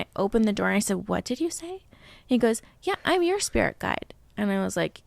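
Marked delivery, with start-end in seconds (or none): 1.1-1.8 high, soft disbelieving question; retold startled question. Startled mother questions her young son. 2.8-4.1 high-pitched imitation of a small boy; four-year-old son. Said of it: "when he was four".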